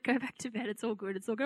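soft, quiet, unbothered reassurance; sleepy reassurance. Retold or imitated. Husband soothing his panicking wife at midnight, soft and quiet. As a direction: murmur it like a half-asleep husband.

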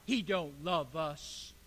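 slow, low sneering reply; sneering sarcasm. Acted. People talking back to Malachi, sneering. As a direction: draw it out in a scornful low voice. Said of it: "with sneering".

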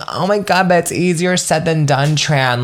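lightly voiced listener protesting to the host; whiny listener. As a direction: imitate a listener whining back at her. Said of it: "but you keep saying".

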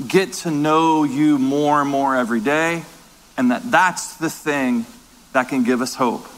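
hushed and reverent, speaking to God; closing prayer. Acted.

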